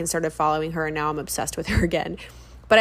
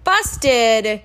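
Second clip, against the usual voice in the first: loud, playful busted call; 3 semitones higher, 5 dB louder than usual. Acted. Teasing after being caught.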